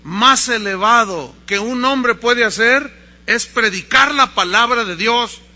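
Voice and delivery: loud, slow preacher's proclamation; ringing pulpit declaration; Wycliffe. Retold or imitated. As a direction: declaim it at full force, word by word. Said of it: "Wycliffe siguió diciendo".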